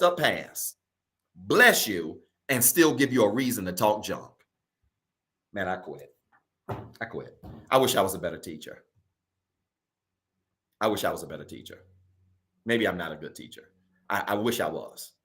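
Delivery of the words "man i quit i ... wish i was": low, soft, quick mock-defeated mutter; mock resignation. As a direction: play at giving up, voice sinking low and soft. Preacher jokingly gives up teaching.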